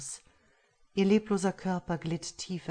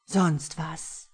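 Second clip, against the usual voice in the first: mocking sneer; 4 dB louder than usual. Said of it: "fragte Luc höhnisch".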